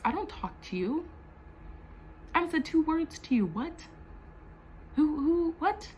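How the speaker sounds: protesting a rumour about someone she never speaks to; indignant disbelief. Retold or imitated. Outraged disbelief. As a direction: pitch jumps high in outraged disbelief.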